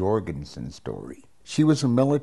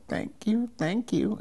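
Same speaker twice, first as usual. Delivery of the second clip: small, high-pitched timid thanks; 12 semitones higher, 3 dB quieter than usual. Retold or imitated. Patient answering the doctor's weight-loss compliment, timidly. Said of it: "I very timidly kept going".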